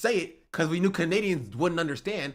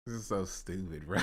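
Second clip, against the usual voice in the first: ordinary talk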